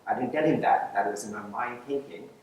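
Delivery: ordinary delivery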